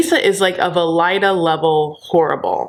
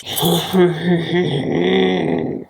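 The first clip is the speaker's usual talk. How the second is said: mock villain laugh; 2 semitones lower than usual. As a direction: hum out a slow scheming laugh. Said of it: "settling into, like, this".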